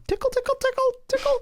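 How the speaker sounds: tiny squeaky falsetto; very high, soft, quick sing-song patter; mock tickling voice. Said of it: "and going".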